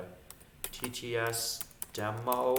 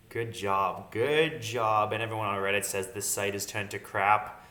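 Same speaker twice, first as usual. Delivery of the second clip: ordinary delivery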